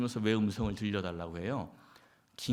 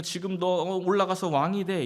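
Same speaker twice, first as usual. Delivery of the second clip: higher, louder eager voice of God; hoped-for answer; 7 semitones higher, 6 dB louder than usual. Retold or imitated. Preacher voicing the reply David hoped for, high and eager. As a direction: burst out with the answer you longed for. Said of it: "이렇게 대답하실 걸 기대했는데".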